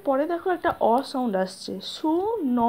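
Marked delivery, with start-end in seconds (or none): 1.9-2.6 সু ন in two separate, drawn-out syllables; sounded-out syllables. Shown as a demonstration. Teacher showing the hidden vowel sound, sounding out সু ন slowly. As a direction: stretch ন so its open "o" vowel is heard. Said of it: "তো এই অ সাউন্ডের জন্য".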